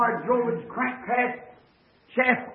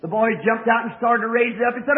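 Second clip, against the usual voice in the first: ordinary talk, nothing marked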